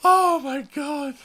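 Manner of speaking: high, slow gasp between laughing fits; helpless giggles. Real, not acted. Player recovering from laughing at the game, still giggling. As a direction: gasp it out mid-laughing fit. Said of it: "I got the giggles now".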